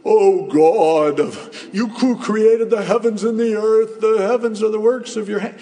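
loud, high, grandiose churchy prayer; hammed-up lofty preacher voice; pompous prayer. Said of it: "it doesn't have to be".

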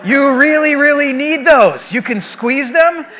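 drawn-out, building to high and loud; mock kid emphasis, slow and stretched; imitating young daughter. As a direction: play an insistent child explaining to Dad. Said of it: "And she goes".

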